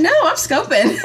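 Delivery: cheeky refusal to clean first; defiant. Retold or imitated. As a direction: plant your feet and refuse playfully. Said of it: "I'm like".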